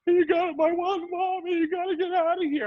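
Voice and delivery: very high, sustained mock child wail; wailing kid. Kid sending the neighbour away, high mock wail. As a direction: imitate a panicked child's shrill yell. Kid yelling through door, retold.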